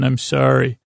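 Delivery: ordinary talk, nothing marked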